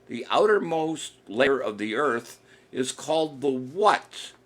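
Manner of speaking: drawn out, slowed-down question; patient quizmaster repeating the question slowly. Shown as a demonstration. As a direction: spell the question out slowly for the players. Host repeats quiz question slower.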